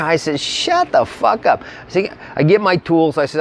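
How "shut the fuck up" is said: exasperated retort. Retold or imitated. Telling panicked friend to stop.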